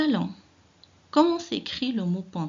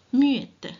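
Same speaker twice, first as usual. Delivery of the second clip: louder and higher burst on muettes; teacher reveals the answer with a pop; emphatic answer; 5 semitones higher, 7 dB louder than usual. Acted. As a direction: land muettes hard after the hush. Teacher punches answer after whisper.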